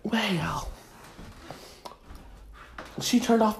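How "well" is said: breathy whispered "Well"; hushed conspiratorial aside; gossip whisper. Acted. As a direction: drop to a secretive whisper.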